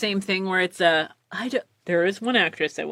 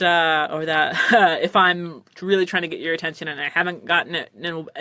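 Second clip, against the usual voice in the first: normal talking voice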